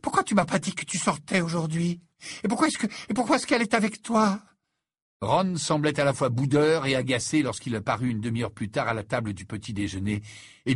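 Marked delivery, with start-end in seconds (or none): 0.0-4.4 high, fast jealous demands; exclaiming. A narrator's reading. Lavande demanding answers from her boyfriend Ron, high and fast. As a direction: pester him, pitch climbing higher. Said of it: "la Van s'exclamer".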